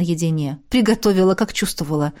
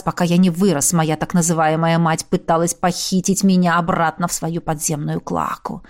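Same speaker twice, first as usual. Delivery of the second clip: ordinary delivery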